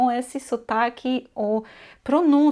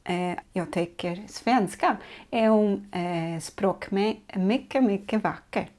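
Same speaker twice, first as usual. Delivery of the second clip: halting Swedish demo with the language's own lilt; Swedish intonation. Shown as a demonstration. [name] speaking Swedish on request to show Swedish intonation. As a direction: demonstrate Swedish with its native melody. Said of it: "escuta aí o que que é falar sueco".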